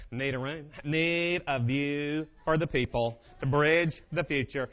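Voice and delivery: slow, drawn-out presidential impression; George Bush Senior. Guest at hotel desk, slow mock-presidential voice. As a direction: stretch it like a president's speech. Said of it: "I can't do George Bush Senior that well".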